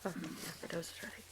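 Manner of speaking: quick whisper to herself before speaking; whispered aside. Real, not acted. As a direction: breathe it out as a private aside. Quiet aside before the eulogy.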